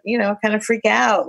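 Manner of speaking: ordinary talk, nothing marked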